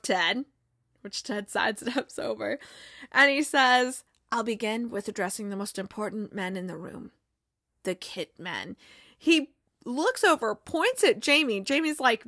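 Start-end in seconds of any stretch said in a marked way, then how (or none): 4.3-8.8 low, hushed, solemn mock-prophet; grand soft Zava voice. Star player solemnly greeting his new manager, low and hushed. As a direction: intone it like a self-serious guru.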